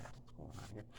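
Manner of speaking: breathy whisper to himself. Retold or imitated. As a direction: whisper it like a secret thought. Thinking to himself in the shop.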